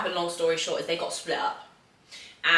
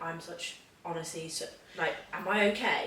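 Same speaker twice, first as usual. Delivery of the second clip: quiet self-doubt; 2 semitones lower, 8 dB quieter than usual. Retold or imitated. Retells asking herself if okay.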